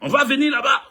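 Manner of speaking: mock outrage, ready to come over; sisters' voice. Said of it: "Mes soeurs, mes soeurs même m'ont appelé".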